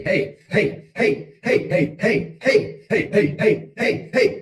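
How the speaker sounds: high, loud voice-warming hey, hey; vocal warm-up. Shown as a demonstration. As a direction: shout hey over and over, bright and high to loosen the voice. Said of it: "I beat on my chest and growl".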